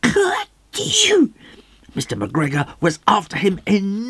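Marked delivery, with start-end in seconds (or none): 0.0-1.1 squeaky little sneeze sound; a sudden tiny sneeze; high sneeze. Acted. Peter sneezes.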